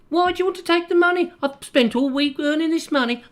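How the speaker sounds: very high, hurried cartoonish voice; squeaky mock victim. Victim protesting to a gangster, very high mock Brummie voice. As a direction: protest in a silly falsetto.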